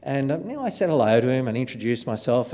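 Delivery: ordinary talk, nothing marked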